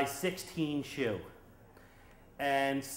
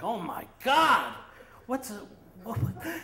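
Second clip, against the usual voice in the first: shocked cry; 8 semitones higher than usual. Retold or imitated. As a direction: gasp it out, stunned. Tom shocked at the haircut.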